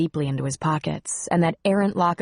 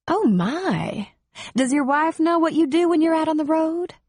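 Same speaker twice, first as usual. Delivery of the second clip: high-pitched, teasing reproach; playfully scandalized woman; mock shock; 11 semitones higher than usual. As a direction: feign scandal in a much higher voice. She scolds the brazen flirt.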